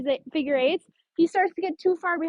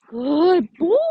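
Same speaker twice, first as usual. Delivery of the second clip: louder praise for the dog; high praise; 7 dB louder than usual. Shown as a demonstration. Trainer praising her dog for sitting. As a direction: reward him with your voice. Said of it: "I give him praise at that high pitch".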